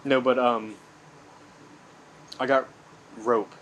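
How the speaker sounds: ordinary delivery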